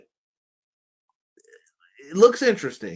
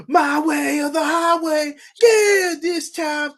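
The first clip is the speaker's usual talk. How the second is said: shouted catchphrase; 11 semitones higher, 5 dB louder, slower than usual. Retold or imitated. Quoting a wrestler's catchphrase.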